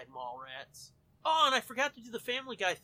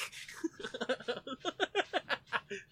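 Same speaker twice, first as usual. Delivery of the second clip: laughing; 7 semitones higher than usual. Real, not acted. Laughing after yell.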